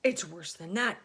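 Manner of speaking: cried out. A narrator's reading. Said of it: "cried the chief of police".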